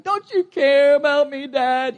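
a light imitation of a child's plea; lightly voiced complaining child; whining kid. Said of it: "sometimes they're like".

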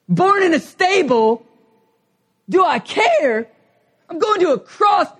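high, loud hammed-up grievance; indignant Jesus rant. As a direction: build to a shout on cross. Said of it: "he just wants to be like".

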